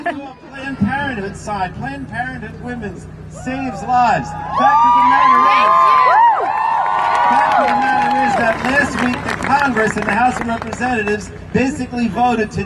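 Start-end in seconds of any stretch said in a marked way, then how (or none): 4.3-8.3 much higher, loud rousing shout; rally shout. Real, not acted. Congressman to a rally crowd, loud rousing shout.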